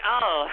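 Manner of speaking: put-on "oh" into a giggle; mock delighted laugh. Host acting the reaction he demands, mock giggling. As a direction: fake a tickled, tittering reaction. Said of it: "you should be like".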